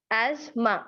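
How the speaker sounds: "asthma" as az-ma, no th; two-sound asthma. Shown as a demonstration. Teacher repeating the right "asthma" for learners, az-ma with silent th. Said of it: "right sound है ना वो दो हैं".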